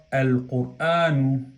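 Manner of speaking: "al qur'anu" slow, L sounded before the q; lam pronounced. Shown as a demonstration. Teacher demonstrating the article before qaf, slowly pronounced lam. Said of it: "hemos pronunciado la lam".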